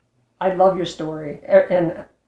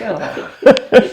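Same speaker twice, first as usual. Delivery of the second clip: laughing, louder "oh" of sudden realization; 3 semitones higher, 8 dB louder than usual. Retold or imitated. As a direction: catch the clue with a laugh.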